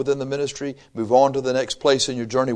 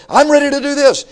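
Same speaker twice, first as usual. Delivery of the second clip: high, loud eager volunteer; zealous eagerness; 13 semitones higher, 5 dB louder than usual. Acted. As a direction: imitate an overexcited newcomer jumping in. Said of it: "you've got this zeal".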